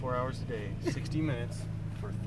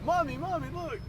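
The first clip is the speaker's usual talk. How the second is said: put-on high, whiny little kid calling mommy; kid's voice; 13 semitones higher than usual. Friend imitating a little kid calling his mom, high. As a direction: mimic the kid in the back seat tugging at mom. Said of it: "He's like".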